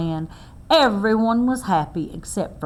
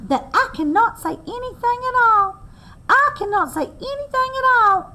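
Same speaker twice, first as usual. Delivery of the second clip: pleading squirrel; 13 semitones higher than usual. Acted. Trapped Squirrel begging Bear to lift his paw: high squeaky pleading. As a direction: plead like a squashed little squirrel.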